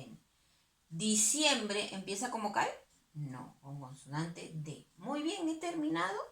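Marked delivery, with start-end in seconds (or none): 5.0-6.2 much higher, bright announcement of being done; pleased finish. Acted. Teacher cheerfully announcing the finished chart to pupils.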